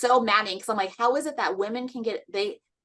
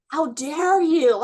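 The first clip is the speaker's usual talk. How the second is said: indignant; 5 semitones higher, 5 dB louder, much slower than usual. Retold or imitated. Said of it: "she turned on me and was like".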